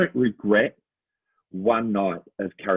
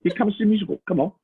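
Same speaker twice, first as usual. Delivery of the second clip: higher, coaxing hawker calling to passersby; pushy street spruiker; 8 semitones higher, much faster than usual. Retold or imitated. Handing out pamphlets to passersby, a coaxing spruiker call. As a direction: lightly play a tout pulling people in off the street. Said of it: "giving out pamphlets to people and saying".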